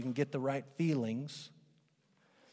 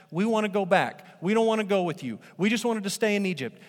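high, loud, whiny protest; whining Israelites; 6 semitones higher, 6 dB louder than usual. Retold or imitated. Israelites refusing God, loud whiny protest. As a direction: complain like stubborn kids. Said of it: "That's what they're doing".

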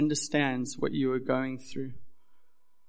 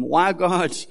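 loud, higher anguished plea; a pleading believer shouting up to God; crying out to God; 4 semitones higher, 7 dB louder than usual. Retold or imitated. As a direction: cry out in protest to God. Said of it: "as we kind of cry out to Him".

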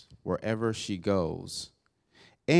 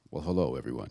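much lower, put-on host voice; deep announcer voice; 9 semitones lower, much faster than usual. Shown as a demonstration. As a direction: drop low like a show host. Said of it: "see if I can try it".

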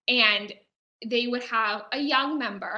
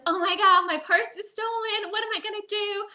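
high, hammed-up panicked cry for help; mock distressed victim; 9 semitones higher than usual. Pickpocket faking panic to passing gentlemen. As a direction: play the frantic robbed lady. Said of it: "And she would be like".

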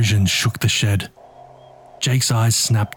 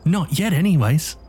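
mocking threat; 6 semitones higher than usual. A narrator's reading. Nick threatens, high.

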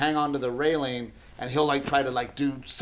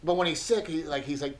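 ordinary talk, nothing marked

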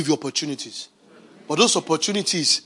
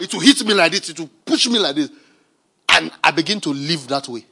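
normal talking voice

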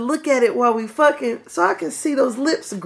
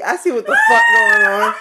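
laughing shout; 6 dB louder than usual. Real, not acted. Laughing outburst.

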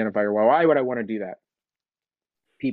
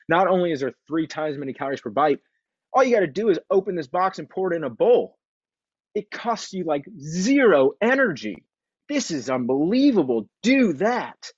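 much higher, gushing like a thrilled inner voice; excited body voice; 7 semitones higher than usual. Retold or imitated. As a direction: play your greedy body cheering the cereal. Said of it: "my body looks at the Fruity Pebbles and goes".

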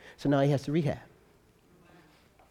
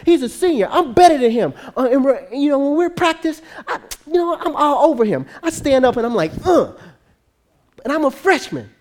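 high, louder boastful complaining; worked-up mock bragging, pitched up; imitated grumbling; 7 semitones higher, 5 dB louder than usual. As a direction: play a cocky player griping to his mom. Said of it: "but he's grumbling all the time".

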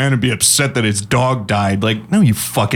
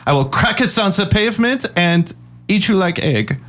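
mock German talk; 7 semitones higher than usual. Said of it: "I'm done with German talk".

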